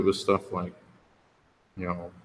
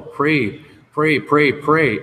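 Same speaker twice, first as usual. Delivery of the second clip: louder with each pray, hammering it home; insistent sponsor; 6 semitones higher, 6 dB louder, much slower than usual. Retold or imitated. Sponsor urging the newcomer, rising insistent repetition. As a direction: drive the word pray in harder each time.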